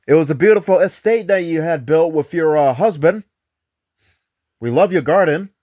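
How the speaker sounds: high, loud questions thrown into the empty room; calling out to spirits. Retold or imitated. Ghost hunter calls out questions to Edith's spirit, loud and high. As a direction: call out to the spirit as if it might answer.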